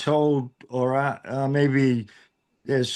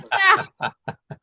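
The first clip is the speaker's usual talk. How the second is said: high-pitched giggling laughter. Real, not acted. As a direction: laugh at your own comeback. Laughing after own comeback.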